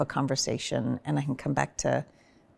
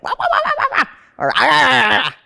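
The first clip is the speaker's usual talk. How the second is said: high wailing wahs then loud ahs; funny release sounds; 9 semitones higher, 8 dB louder, faster than usual. Shown as a demonstration. Showing partner the sounds she made for her kids, wailing funny noises. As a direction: wail and grunt while pushing the wall. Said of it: "make funny sounds like".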